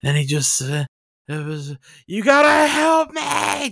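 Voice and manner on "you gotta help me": high, drawn-out cry for help; hammed-up wail, stretching the words; retold scream. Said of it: "Just screaming".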